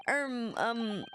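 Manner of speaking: ordinary talk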